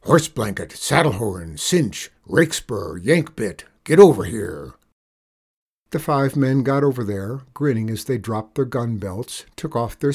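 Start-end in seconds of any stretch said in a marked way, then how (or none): 0.0-4.7 loud roll call ending in a shouted order. A narrator's reading. Outlaw boss calling five men over, commanding. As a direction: summon each man by name. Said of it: "And he called out".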